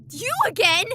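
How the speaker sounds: usual speaking voice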